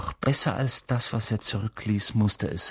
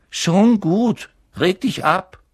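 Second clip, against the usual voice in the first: put-on higher, louder, cheeky teen boy; brushing it off; 10 semitones higher, 7 dB louder, slower than usual. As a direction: wave her off like a cheeky son. Twin brushing off his mother.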